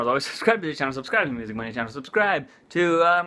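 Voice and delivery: ordinary talk, nothing marked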